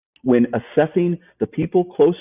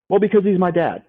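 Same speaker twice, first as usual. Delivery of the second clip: mock defensive whine; 7 semitones higher than usual. Quoting a defensive listener.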